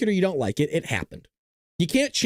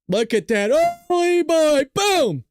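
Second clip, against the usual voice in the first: excited; 11 semitones higher, 4 dB louder, slower than usual. Real, not acted. Excited at bomb footage.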